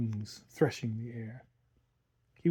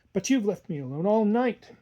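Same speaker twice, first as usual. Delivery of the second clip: reproachful; 7 semitones higher, 3 dB louder than usual. A narrator's reading.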